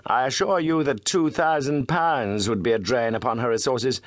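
firm, pleading gentleman detective; lightly voiced, insistent Holmes; earnest bargaining.

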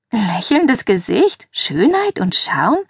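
slow, drawn-out questioning echo; teasing, stretched-out question; amused disbelief. Acted. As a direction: echo the flattery slowly, rising in disbelief. Mock echo of friend's flattery.

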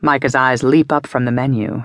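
plain reading voice

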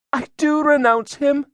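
put-on very high, loud accusing girl's voice; entranced girl. A narrator's reading. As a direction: testify in a trance, high-pitched throughout. Accused girl renounces Satan.

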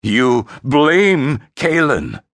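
slow, loud, high scorn; incredulous, scornful emperor; snorting contempt. Acted. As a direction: draw it out in disbelief and contempt. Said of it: "the Emperor snorted again".

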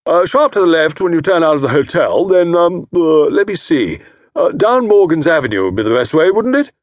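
loud, high, jovial teasing; hearty old fellow. A narrator's reading. Jovial host teasing Tommy about his wife, hearty. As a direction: slap him on the back with it. Estcourt giving directions.

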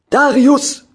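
loud high call across the yard; bellowing a name. Acted. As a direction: yell the name from afar. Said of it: "hörte ich Bauer Helmut brüllen".

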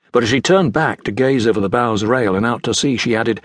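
plain reading voice

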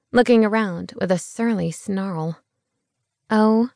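ordinary delivery

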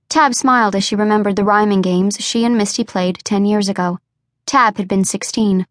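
ordinary delivery

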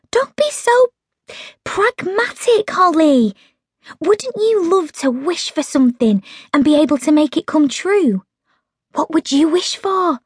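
Meg coaxing. A narrator's reading. Meg urging pragmatic Holly to wish, high and coaxing. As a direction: coax her friend into dreaming.

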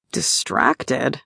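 low, quiet questioning repeat; a hint of confusion; softly baffled Shane; puzzled echo. A narrator's reading.

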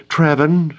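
high-pitched exclamation of his name; startled cry. A narrator's reading. Jenna finds Traven behind her, startled cry. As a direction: cry out as if caught off guard. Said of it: "Jenna cried".

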